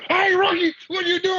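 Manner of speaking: a rookie retelling how the veterans shouted at him after a hit on a teammate; teammates yelling. Retold or imitated. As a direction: holler it like angry veterans. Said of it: "They like".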